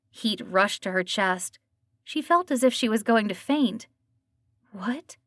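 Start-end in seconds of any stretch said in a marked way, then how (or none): 4.7-5.2 barely squeezed out. A narrator's reading. Dana shocked by the review, barely audible. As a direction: squeeze the word out of a tight throat. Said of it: "barely squeezed the word out".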